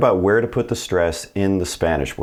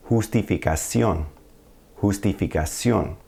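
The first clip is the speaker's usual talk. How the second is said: "Justificación" stressed on final -CIÓN; stress on accented vowel. Shown as a demonstration. Teacher gives accent-mark example Justificación, stressed CIÓN. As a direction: land on the last syllable of "Justificación". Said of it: "That's where you're gonna put the stress".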